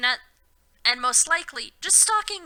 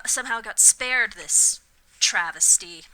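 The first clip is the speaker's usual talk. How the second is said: usual speaking voice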